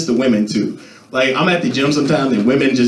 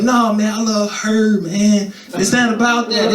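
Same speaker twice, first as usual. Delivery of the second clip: mock lovesick plea; 7 semitones higher, slower than usual. Lovesick self, pleading.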